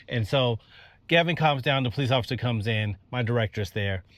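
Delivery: normal talking voice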